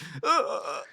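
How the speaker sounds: mock sobbing gasps; imitated ugly-cry gasps. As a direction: gasp as if bawling, imitated. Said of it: "ugly cry".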